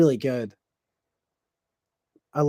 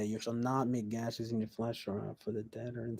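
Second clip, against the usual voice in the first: scripture reading; 4 semitones lower, 13 dB quieter than usual. Acted. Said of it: "reads Bible verse".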